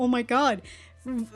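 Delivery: normal talking voice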